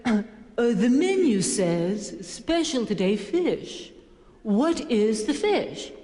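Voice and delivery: very slow, haughty enunciation; hammed-up superior customer; acting snobby. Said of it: "I'm trying to be a snob".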